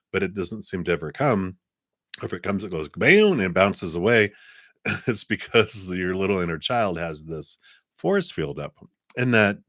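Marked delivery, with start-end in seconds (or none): none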